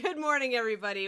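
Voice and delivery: usual speaking voice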